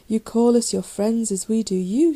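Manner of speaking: loud hurt angry shout. A narrator's reading. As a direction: shout it at the false friend. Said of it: "shouted Albright".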